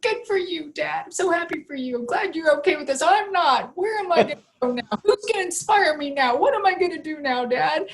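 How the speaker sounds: high-pitched, mock-tearful wail; retold crying. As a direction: imitate bawling at Dad, pitch held high. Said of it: "And I'm crying".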